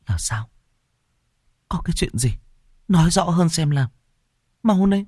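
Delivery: urgent, frightened mistress; mother in panic demanding the truth; panicked demand. Acted. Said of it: "Nhai hoảng hốt".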